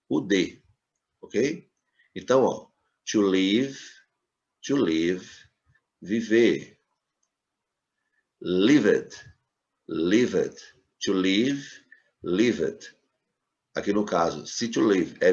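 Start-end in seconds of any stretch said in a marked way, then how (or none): none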